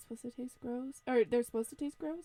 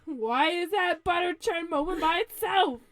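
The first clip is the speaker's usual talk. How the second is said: loud, higher incredulous cry; mock alarm; 5 semitones higher, 8 dB louder than usual. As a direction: join in as the frightened wolf. Listener joins as alarmed wolf.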